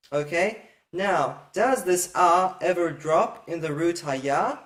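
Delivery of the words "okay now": ordinary talk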